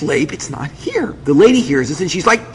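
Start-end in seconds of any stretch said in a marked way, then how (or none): 0.0-1.1 slow, soft hushed voice; whisper. Retold or imitated. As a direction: whisper each word to your husband. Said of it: "Chana whispers to me".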